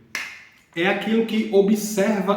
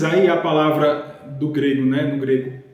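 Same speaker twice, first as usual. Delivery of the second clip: normal talking voice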